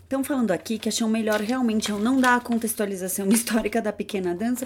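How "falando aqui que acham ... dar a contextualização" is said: ordinary delivery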